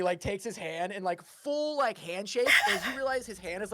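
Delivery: ordinary talk, nothing marked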